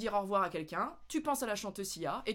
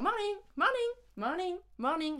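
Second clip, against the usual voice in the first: high quick morning, morning; clipped morning greeting; 9 semitones higher than usual. Shown as a demonstration. Greeting people crossed on the path, high and quick. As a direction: toss off morning to passers-by. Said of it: "tu croises des gens".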